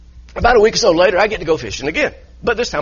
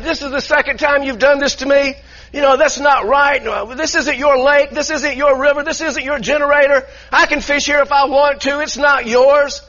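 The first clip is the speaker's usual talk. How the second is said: higher, raised-voice replay of a shouting match; retold angry yell; 6 semitones higher than usual. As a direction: relive yelling at the boater, a touch hammed-up. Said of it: "and we're yelling".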